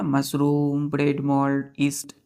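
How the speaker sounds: usual speaking voice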